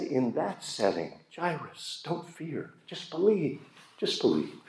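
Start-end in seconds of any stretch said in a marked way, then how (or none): 1.3-4.6 gentle reassurance. Acted. Jesus comforting the dying girl's father.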